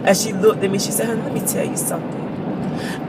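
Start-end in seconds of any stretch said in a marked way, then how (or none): 1.1-3.0 put-on hushed, breathy old grandmother's voice; grandma's soft whisper. Grandma whispering her thanks to her granddaughter, hushed. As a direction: lean in and whisper it like a frail grandma.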